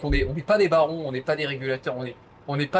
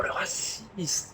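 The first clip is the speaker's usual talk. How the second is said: whispered; 4 semitones higher, 8 dB quieter, much slower than usual. Acted. Mock whispered accusation.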